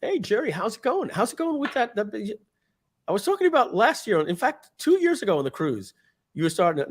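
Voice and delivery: greeting Jerry. Retold or imitated. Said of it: "I see people at a live event and I'm like".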